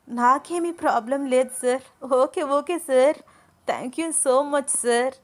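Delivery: high, loud, drawn-out happy thanks; delighted. Acted. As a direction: stretch each word in glee. Ananya delighted at class offer. (ఆనందంతో సాగదీసిన కృతజ్ఞతలు)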